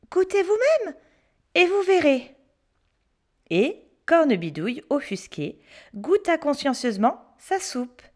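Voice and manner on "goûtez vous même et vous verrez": put-on high, sly daring child. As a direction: tempt her to taste. Pierre dares the witch.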